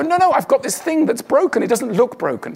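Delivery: a hint of a wounded plea; pleading protest. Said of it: "But you wanna go".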